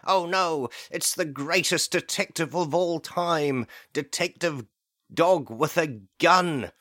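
a villain caught, squealing a little; mock panic.

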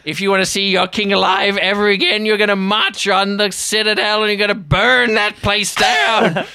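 high, loud, fast, half-laughing threats; unhinged goblin laying out his terms, high and loud; manic ultimatum. Acted. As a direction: bark demands with a knife at the king's throat. Norbert issues his hostage demands.

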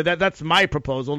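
ordinary talk, nothing marked

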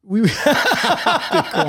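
high, loud real laughter through the words; laughing. Real, not acted. Friend cracking up at a joke about not being monsters, laughing. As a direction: crack up while saying it.

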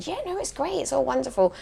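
high, soft, everything's-fine voice; put-on cheery persona. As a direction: feign that all is wonderful. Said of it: "a persona to other people going".

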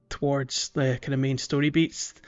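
normal talking voice

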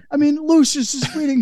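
ordinary talk, nothing marked

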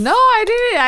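high, loud denial; indignant protest. Real, not acted. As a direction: shoot back, offended. Defending against a teasing accusation.

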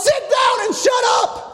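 high, shouted rebuke; exasperated shout from a crowd; angry mob. Acted. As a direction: yell to silence someone. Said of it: "the mob goes".

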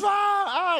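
high, loud imitated scream; mock scream. Daughter beside dad as the coaster takes off, mock scream. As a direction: imitate a child screaming on a ride. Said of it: "I hear her screaming".

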